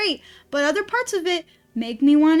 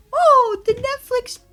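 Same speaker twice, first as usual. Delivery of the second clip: high, sing-song mock praise for the show; mocking fans; 8 semitones higher, 4 dB louder than usual. Streamer mocking fans who praised Pluto, high mock gush. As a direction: parody the gushing reviewers, higher. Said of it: "tippity typed on your little typer".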